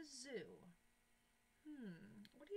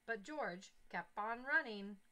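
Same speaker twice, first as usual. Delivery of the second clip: put-on reading to children; 4 dB louder than usual. A narrator's reading. Teacher reads story aloud.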